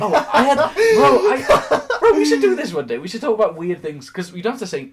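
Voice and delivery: real excited laughter; excited laughing. Real, not acted. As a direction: burst out with the idea while laughing.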